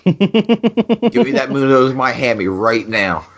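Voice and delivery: slow, high, put-on voice drawing out a cocky order; mock demanding order. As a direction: order the food like a swaggering big shot, slowly. Ordering at Denny's, acted.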